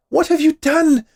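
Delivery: high, loud shriek of a trapped witch; witch crying out. Acted. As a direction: shriek it from inside the oven. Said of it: "the witch cried from inside".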